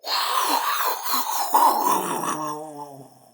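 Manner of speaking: huge intake, then loud roaring blow; loud, long dragon exhale trailing into a low groan; dragon's fire breath. A narrator's reading. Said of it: "he took a huge, deep breath".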